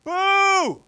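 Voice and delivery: high, loud drawn-out boo; heckler's scream. Acted. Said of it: "she didn't scream out".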